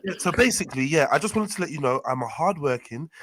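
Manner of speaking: loud put-on posh job call; plummy interview voice; pretend posh voice. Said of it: "my pretend posh voice".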